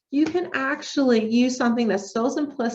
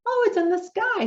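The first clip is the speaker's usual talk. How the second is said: mock discovery; 10 semitones higher than usual. Shown as a demonstration. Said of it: "do you think it's somewhere else?".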